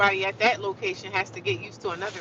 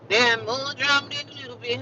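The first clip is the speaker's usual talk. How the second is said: higher, put-on emphatic voice; played-up repeat; 5 semitones higher than usual. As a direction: say it over in a hammed-up high voice. Repeats it, playing it up.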